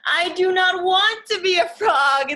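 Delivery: high, loud, firm refusal; upset refusal. Acted. Gerald refusing Piggy's frog idea, loud and upset. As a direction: protest it loudly like a scared elephant.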